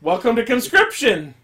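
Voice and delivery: loud, high-pitched exclamation; emphatic shout. Real, not acted. Podcaster hammering the point to co-hosts, shouted. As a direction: shout it as an exasperated announcement. Repeats own line, now shouting.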